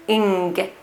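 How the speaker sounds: "ing" ending on a back-of-mouth NG; received pronunciation NG. Shown as a demonstration. Teacher isolating the ending for learners, RP NG. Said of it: "with our tongue raised at the back of our mouths".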